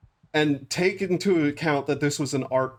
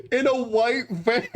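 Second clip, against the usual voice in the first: higher-pitched, breaking into laughter; real laughing; 6 semitones higher than usual. Real, not acted. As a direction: let the last words collapse into laughter. Storyteller cracking up at own story.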